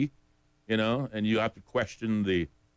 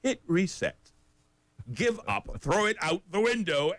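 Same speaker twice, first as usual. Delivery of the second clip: higher and higher, getting carried away; escalating advice; 10 semitones higher than usual. Pretend. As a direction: build up to an exasperated peak. Mock tech-support rant.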